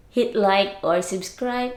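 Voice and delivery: normal talking voice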